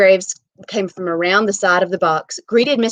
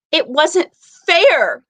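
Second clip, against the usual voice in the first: high, slow wail of protest; despairing protest; 10 semitones higher, 7 dB louder, much slower than usual. Acted. Wife protesting the drawing, slow high wail. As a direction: drag out the complaint, high.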